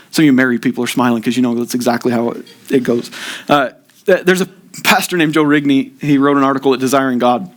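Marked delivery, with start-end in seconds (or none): none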